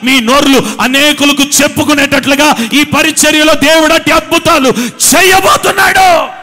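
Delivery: loud preaching rising to a high shout; fiery preaching. Real, not acted. Pastor promising miracles to the congregation, fiery. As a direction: preach it, building to the top.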